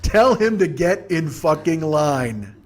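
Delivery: half-speed punchline, every word drawn out; slow, emphatic. Real, not acted. Comic repeating his verdict on bored kid, slow emphatic delivery. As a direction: spell it out slowly for effect.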